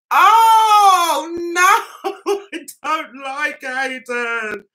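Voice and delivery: high wail easing into slow moan; wail, then whine slowly through a laugh; hammed-up dismay. Real, not acted. Viewer moaning that Aidan is back, dismayed wail. Moaning about disliked character.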